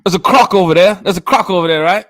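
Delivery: loud, high mock-Australian croc spotter; big, shouted Aussie impression; Australian accent. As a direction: call out in a hammed-up Australian accent. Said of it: "that's not Australia".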